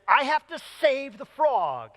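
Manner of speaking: put-on pleading like a determined little girl; urgent child's plea. Daughter begging dad as a car comes, urgent.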